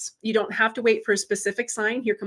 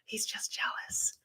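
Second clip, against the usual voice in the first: breathy whispered secret; whispered aside; 14 dB quieter, slower than usual. Real, not acted. Host confiding to viewers about her jealous husband, whispering. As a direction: drop to a whisper as if he might hear.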